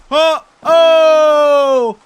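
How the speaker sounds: high, loud, drawn-out whoop; excited shout. Real, not acted. Player whooping at his own behind-the-back throw, loud and high. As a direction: yell it like you nailed a trick.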